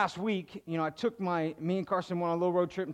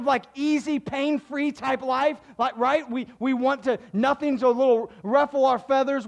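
louder, higher, driving sermon delivery; full-force preaching; 9 semitones higher, 8 dB louder than usual. Acted. Pastor urging the congregation to pray personally, loud and fervent. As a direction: push it out like a pastor building the point.

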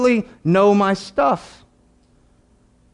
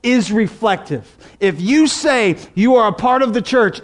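ordinary delivery

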